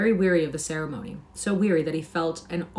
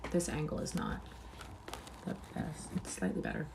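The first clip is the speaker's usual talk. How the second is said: whisper; 13 dB quieter than usual. Real, not acted. Aside about camera angle.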